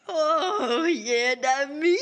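loud, high, crying answer; tearful. Acted. Player as Boudreaux answers sick uncle in the cage, tearful.

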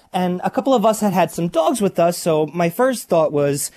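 ordinary talk

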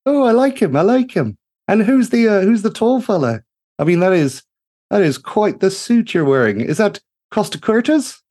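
bright-eyed gnome engineer calling out in delight; gushing gnome mechanic. Acted. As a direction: sing it out like a cheery little tinkerer. Greta sizing up the crew.

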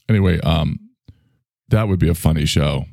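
usual speaking voice

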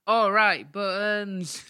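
loud, high pantomime shout; hammed-up catchphrase to the crowd; panto call.

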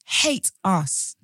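loud, high, aggrieved outburst; indignant pleading. Acted. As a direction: demand an answer from her, wounded and heated. Heated mock address to absent actress.

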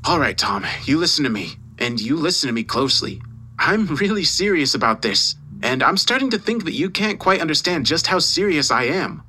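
venom with a touch of restraint; mildly menacing boss; venomous. A narrator's reading. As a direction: lay down a stern warning, building in pitch. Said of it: "The sudden venom in his tone".

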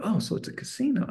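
high, soft imitation of an amazed kid; mock wonder, small high voice; dawning realisation. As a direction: echo it as a child catching on. Boy catches on, echoing dad.

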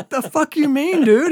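loud, high-pitched incredulous demand; retold shock. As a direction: blurt it out as a mock-alarmed comeback. Retold shocked reply to friend.

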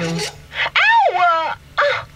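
sharp, very high hammed-up ow; pained yelp. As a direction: yelp like a poked eye. Mirror pushed, car in pain.

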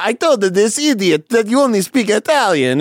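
high, singsong mock Italian; hammed-up Italian accent. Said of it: "stuttering in these accents".

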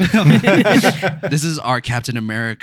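slow, laughing through a mock British boast. [name] mocking British listeners, laughing. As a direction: giggle through the imitated listener's line. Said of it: "They're like".

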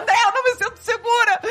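high, laughing imitation of her own panic; half-laughing mock fear; mock panicked whimper. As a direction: whine it like a shaken woman begging to leave. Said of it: "Aí eu tava".

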